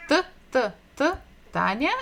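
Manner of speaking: stressed repeated Т, Т before Таня; sounding out T. Shown as a demonstration. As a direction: tap out the Т sound, then say Таня. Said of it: "the first sound, T, T".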